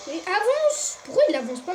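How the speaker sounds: yelling at the game; loud, exasperated outburst; frustrated yell. Real, not acted. Gamer yelling at stuck character.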